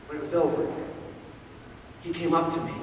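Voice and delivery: ordinary talk, nothing marked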